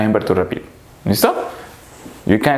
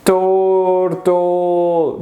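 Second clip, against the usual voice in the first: long drawn-out turtle, higher pitch; slow motion; 4 semitones higher than usual. Shown as a demonstration. Teacher demonstrating a slow animal, slow motion. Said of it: "Yes, slow".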